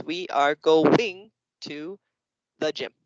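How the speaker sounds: very slow, GOING broken into two beats; syllable-split counting. Shown as a demonstration. Teacher imitating kids miscounting the words, slow and broken up. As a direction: drag out "going" as two words, pause before "the gym". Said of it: "other kids will go like this".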